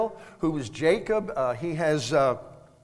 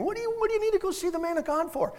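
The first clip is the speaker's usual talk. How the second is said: high, rushed mock bewilderment; baffled rapid-fire question in a raised pitch; clueless husband; 13 semitones higher, much faster than usual. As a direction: play the oblivious husband, quick and high. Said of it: "he doesn't sense anything".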